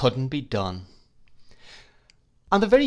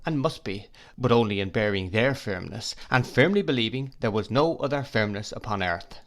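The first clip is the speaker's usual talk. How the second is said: ordinary delivery, nothing marked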